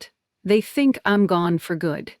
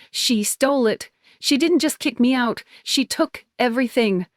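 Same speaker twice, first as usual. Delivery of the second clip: higher, accusing outburst; mild inner scream; 6 semitones higher than usual. A narrator's reading. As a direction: cry of accusation, pitched up but not full volume. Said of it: "my own head screaming".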